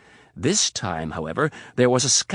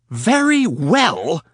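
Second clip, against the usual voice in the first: high, loud, slow scornful echo; spat; 8 semitones higher, 8 dB louder, much slower than usual. Acted. Riley throwing Donatella's words back, spitting them high. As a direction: spit the words back at him. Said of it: "spat Riley".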